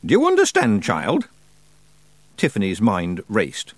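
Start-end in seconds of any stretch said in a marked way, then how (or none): 0.1-1.3 put-on a sharp high demand, testing the girl; stern question. Old witch testing young Tiffany with a stern demand. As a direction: press her hard for an answer. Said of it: "gave ... Tiffany a stern look".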